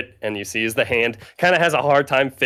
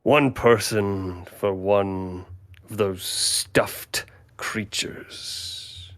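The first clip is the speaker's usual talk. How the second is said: slow, hushed creature bargaining; a sly spirit naming its price, drawn out; 4 dB quieter, slower than usual. Acted. Creature offering a trade to the party, slow and hushed. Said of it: "he looks at the animals and he goes".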